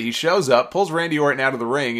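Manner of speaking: ordinary delivery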